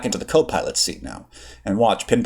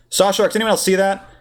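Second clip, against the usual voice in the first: calling over comm; 10 semitones higher than usual. Acted. Pilot calls over comm.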